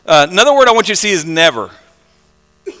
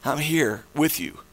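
low, soft and tender; gentle reassurance; 5 semitones lower, 11 dB quieter than usual. Acted. Jesus answering someone lost in the dark, softly reassuring. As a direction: drop to a quiet, steadying voice.

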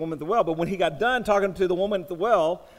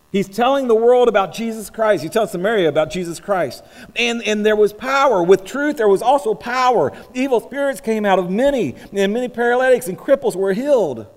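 louder, driving pulpit delivery; forceful preaching; 2 semitones higher, 6 dB louder than usual. Acted. Preacher telling the church of truth and power, forceful preaching. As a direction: proclaim it with rising force.

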